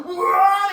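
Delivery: high, very loud "aah"; mock panicked holler; imitated flying yell. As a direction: scream like a cartoon rocket rider. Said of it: "he starts going".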